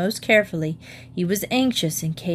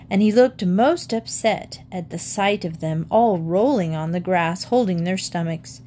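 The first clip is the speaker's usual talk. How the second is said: usual speaking voice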